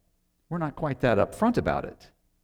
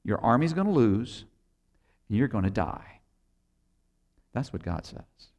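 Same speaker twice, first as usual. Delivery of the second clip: grave, much softer, sinking low at the end; deadly serious; 3 semitones lower, 3 dB quieter than usual. Retold or imitated. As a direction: deliver the bad news without a smile. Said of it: "He gets really serious".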